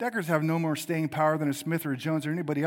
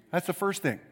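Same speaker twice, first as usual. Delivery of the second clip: ordinary talk, nothing marked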